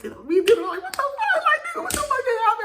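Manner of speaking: very high squealing, loud; retold excited disbelief. To sister in the conference room, excited high squeal.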